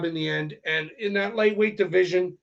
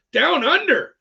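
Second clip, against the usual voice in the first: higher and louder, punching under; a fan's proud cheer for the Aussie nickname; hyped repeat; 8 semitones higher, 4 dB louder than usual. Real, not acted. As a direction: shout Down under with relish.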